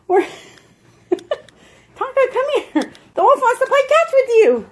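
very high, squeaky dog-talk; high-pitched pet voice; coaxing pet talk. Real, not acted. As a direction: urge the dog on in a high, playful voice.

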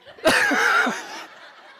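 breathless, squealing laugh; high squeaky laugh. Real, not acted. Recalling the builders, high squeaky laugh. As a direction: laugh helplessly at the memory. Laughing at his own embarrassment.

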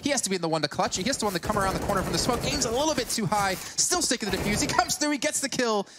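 fast, high esports casting; excited play-by-play. Real, not acted. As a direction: call the clutch with rising hype.

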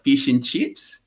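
fish and chips with stretched Australian vowels; Australian accent. Shown as a demonstration. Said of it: "the most Australian version is".

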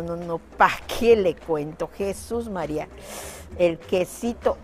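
ordinary delivery

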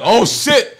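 high, loud burst of hype; retold amazement. Telling a friend his first reaction to the verse, excited shout. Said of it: "first I was like".

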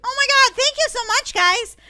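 high, loud, flattered gush; overwhelmed thanks. Acted. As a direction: thank fans with giddy delight. Thanking admiring fans.

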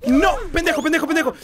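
angry cursing. Real, not acted. Cursing the game character.

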